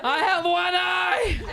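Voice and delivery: mock horror, laughing; laughing panic. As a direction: wail it slowly through laughter. Eyelid slammed shut.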